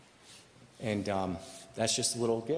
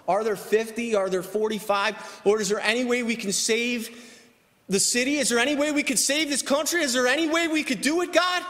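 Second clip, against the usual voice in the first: pleading with God, louder and higher with each question; preacher's rising intercession; impassioned preaching; 10 semitones higher, 6 dB louder than usual. Acted. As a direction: build to a loud, high, urgent cry. Preaches at full force.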